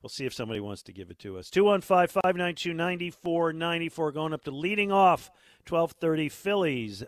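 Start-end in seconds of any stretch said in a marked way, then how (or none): 1.5-7.0 loud announcer read of the number; radio promo read. Real, not acted. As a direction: boom it out like a station promo. Radio host reading phone number.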